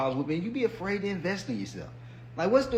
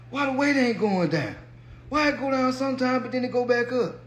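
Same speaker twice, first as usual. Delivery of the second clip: higher-pitched puzzled complaint; mock frustration; 7 semitones higher than usual. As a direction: imitate a baffled dieter protesting. Acts out frustrated dieter on scale.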